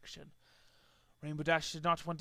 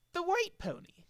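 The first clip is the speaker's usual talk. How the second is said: high clarification; 11 semitones higher, 3 dB quieter than usual. Acted. Trixie clarifying.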